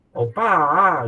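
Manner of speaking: high, loud "Opa" in a K-drama lilt; playful Korean-style "Opa"; Korean intonation. Shown as a demonstration. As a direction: call "Opa" the way a Korean fan would. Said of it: "Intonasinya orang Korea itu sangat khas sekali".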